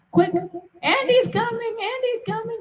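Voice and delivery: urgent warning call. Pretend. Streamer shouting the code warning that Andy is coming, high and urgent. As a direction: hiss the alarm before someone walks in. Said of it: "Yep, that's our code".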